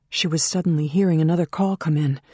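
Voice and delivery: plain reading voice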